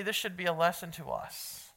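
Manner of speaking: normal talking voice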